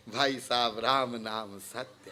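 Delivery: low, very soft, deferential greeting; humble visitor. Acted. Visitor greeting a householder at the door, low and humble. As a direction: say it quietly and meekly, pitched right down. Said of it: "हाथ जोड़ के कहे".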